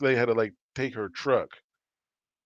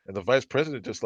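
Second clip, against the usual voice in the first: ordinary delivery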